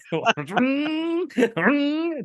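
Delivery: real burst of high laughter. Real, not acted. Podcaster laughing at his co-host's quip.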